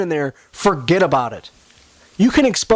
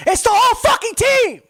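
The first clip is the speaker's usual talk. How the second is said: frustrated yell; 14 semitones higher than usual. Real, not acted. Podcaster erupting at his team's hopeless roster, furious yell. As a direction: blow up at the whole team. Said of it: "I'm frustrated with the Lions".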